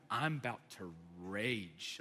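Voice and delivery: very low, slow and soft; ominous restraint. Retold or imitated. Traveler about to rage at the counter, ominous and hushed. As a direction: simmer quietly, drawing out the last word. Mock-ominous telling of anger.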